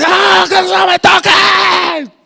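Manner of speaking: high, drawn-out yell; hammed-up outburst on being woken; rudely awakened sleeper. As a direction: yell at them to back off. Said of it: "te despiertan y dices".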